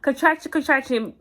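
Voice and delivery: loud, high repeated word; urgent insisting. Retold or imitated. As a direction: hammer it home to make him get it. Insisting to husband she's in labour.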